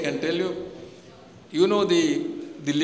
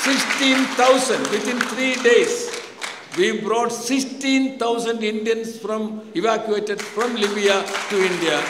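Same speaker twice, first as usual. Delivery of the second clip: proud emphatic announcement; 3 semitones higher, 4 dB louder, slower than usual. Real, not acted. Proudly announcing the evacuation figure.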